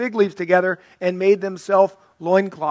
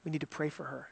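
hushed and much softer, a secret; gossipy whisper; 2 semitones lower, 17 dB quieter, faster than usual. Retold or imitated. Friend at lunch hushing her voice to share gossip. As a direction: lean in and murmur the news. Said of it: "in a whispered tone".

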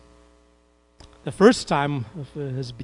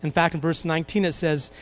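normal talking voice

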